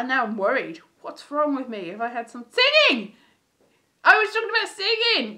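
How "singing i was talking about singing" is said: higher, louder burst of remembering; excited eureka exclamation; sudden realisation. Real, not acted. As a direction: blurt it out as it comes back to you.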